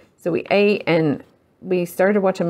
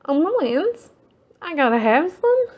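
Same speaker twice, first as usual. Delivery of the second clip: high, excited gush over a friend's tags; 8 semitones higher than usual. Retold or imitated. Seeing a friend's tags, gushing with excitement. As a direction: gush like you just fell in love with them. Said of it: "And I'm like".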